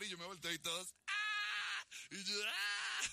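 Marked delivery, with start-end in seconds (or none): 1.1-1.9 high-pitched crowd scream; imitated group yell, high and sustained; excited scream. Imitates the dads shouting along. 2.5-3.0 high, sustained excited shriek; re-enacted fan yell, high-pitched; answering scream. Answers their scream with own.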